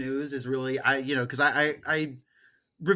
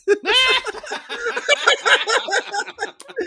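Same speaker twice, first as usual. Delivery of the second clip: high burst of real laughter; loud laughing fit; big laugh; 6 dB louder than usual. Real, not acted. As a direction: crack up helplessly. Host cracks up at story.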